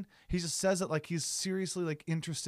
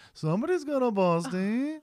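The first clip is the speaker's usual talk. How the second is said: higher, louder put-on voice for his line; mock old man's line; 8 semitones higher, 5 dB louder than usual. Mimicking the man who shouts from his car, hammed-up line. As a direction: ham up the stranger's odd catchphrase. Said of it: "question mark".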